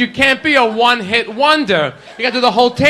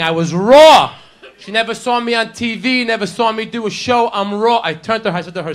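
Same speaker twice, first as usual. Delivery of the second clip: ordinary delivery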